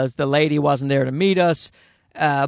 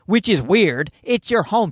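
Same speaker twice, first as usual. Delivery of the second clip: ordinary delivery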